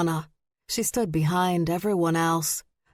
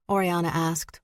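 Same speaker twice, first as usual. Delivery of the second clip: ordinary delivery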